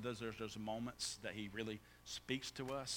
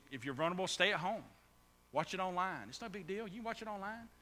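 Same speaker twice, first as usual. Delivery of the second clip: higher-pitched, brushing the concern aside; dismissive excuse, retold; 8 semitones higher, 4 dB louder than usual. Pastor mimics his excuse to vulnerable members, dismissive. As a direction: imitate your own easy excuse, lighter toward the end. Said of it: "I made excuse".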